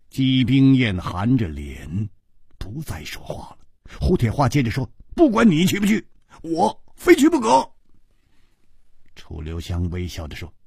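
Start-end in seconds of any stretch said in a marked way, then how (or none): 5.1-7.7 higher, indignant outcry; much higher, incredulous Hu Tiehua; crying out indignantly. A narrator's reading. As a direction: protest at his coldness. Hu Tiehua insists.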